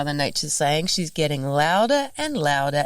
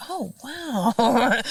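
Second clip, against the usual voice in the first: mock surprise; 6 semitones higher than usual. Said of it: "And I'm like".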